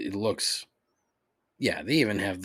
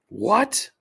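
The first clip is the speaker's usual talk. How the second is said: high, louder incredulous rise; disbelieving what; 11 semitones higher, 7 dB louder than usual. Real, not acted. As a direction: squeak out the question in shock.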